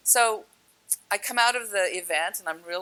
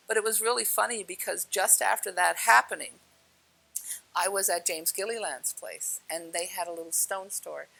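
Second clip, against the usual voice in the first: normal talking voice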